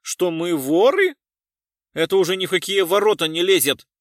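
loud, high indignant protest; outraged. A narrator's reading. Passenger thinks he is accused of theft, outraged. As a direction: throw the accusation back in outrage.

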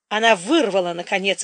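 ordinary delivery, nothing marked

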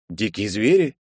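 ordinary delivery, nothing marked

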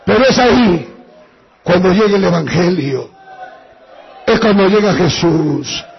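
pastor's fervent altar-call preaching; preaching at full force. Real, not acted. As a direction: proclaim the name with fervor. Preacher rises to climax.

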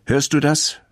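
a hint of a cross old man snapping; angry old man. A narrator's reading. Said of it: "sagt Herr Krupschott böse". (leicht gespielter, empörter alter Mann, höher)

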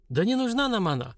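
ordinary delivery

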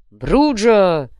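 loud answering battle cry; barked war cry. A narrator's reading. Warriors answer their leader's motto, barked. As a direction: bark back the clan name. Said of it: "рявкнули воины".

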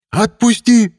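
high-pitched creature's outraged yell; very high, furious demand; indignant cry. A narrator's reading. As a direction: shriek to be let go. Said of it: "негодующе крикнула тварь".

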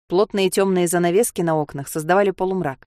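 plain reading voice

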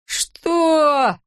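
high drawn-out cry of disbelief; outraged yell. A narrator's reading. Julio hears the tiny offer, high outraged yell. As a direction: wail it in outrage. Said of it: "возопил Жулио".

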